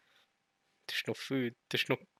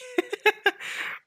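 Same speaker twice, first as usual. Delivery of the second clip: high-pitched giggle; amused laugh. Real, not acted. Laughing at their own word mix-up, amused giggle.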